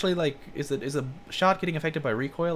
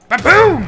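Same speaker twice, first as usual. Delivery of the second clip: big, high "ba-boom" blast; booming mock gunshot; shouted explosion noise; 16 dB louder than usual. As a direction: yell it like a gun going off. Voices the gun's blast.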